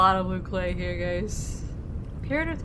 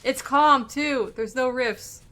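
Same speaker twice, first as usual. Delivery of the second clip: excited; 5 semitones higher, 6 dB louder, much slower than usual. Real, not acted. Relieved at calm weather.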